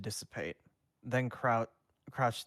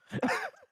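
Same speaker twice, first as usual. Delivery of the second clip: laughing; 4 dB louder than usual. Real, not acted. Reader reacts out of character.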